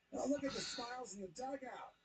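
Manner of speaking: ordinary delivery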